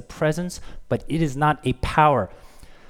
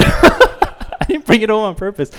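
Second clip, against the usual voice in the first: higher, louder, slow protest through a laugh; defensive husband, laughing, louder; 8 semitones higher, 9 dB louder, slower than usual. Retold or imitated. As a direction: plead innocence while laughing. Defending himself to wife.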